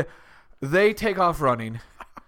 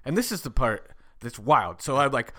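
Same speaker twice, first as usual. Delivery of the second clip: usual speaking voice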